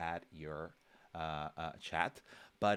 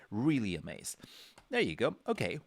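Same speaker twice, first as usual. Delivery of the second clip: usual speaking voice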